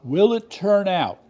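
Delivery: slow, loud, high suspense; dramatic. Real, not acted. Host building suspense for viewers, dramatic. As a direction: lean in with drama. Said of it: "Dramatic pause".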